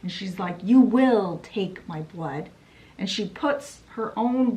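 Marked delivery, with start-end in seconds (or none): 0.6-2.5 loud, slow, forceful order; acted menacing command. Mother grabs her by the hair, slow forceful command. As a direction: command it slowly, hitting each word hard.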